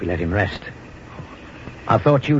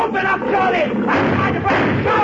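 shouting. Acted. Shouting to be let in.

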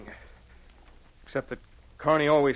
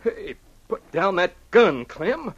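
alarmed; 6 semitones higher, 7 dB louder, slower than usual. Acted. Partner draws gun on him.

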